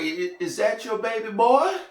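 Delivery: high-pitched, eerie questioning lilt; creepy old lady. Retold or imitated. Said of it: "that's how she talked".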